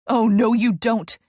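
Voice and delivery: loud protest. A narrator's reading. Therapist stopping the player from walking out, loud. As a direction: block his exit with your voice.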